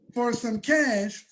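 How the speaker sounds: ordinary talk, nothing marked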